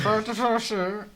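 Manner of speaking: very high, loud, garbled Irish; exaggerated Irish accent. Repeating the farmer's line for laughs, exaggerated Irish accent. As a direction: squeal out a mangled Irish impression. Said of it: "I wanna do that guy's accent".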